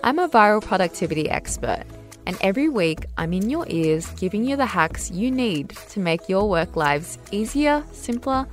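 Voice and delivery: bright podcast host intro; upbeat tidy advice vlogger. Acted. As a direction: welcome listeners like an upbeat host. Upbeat show intro read.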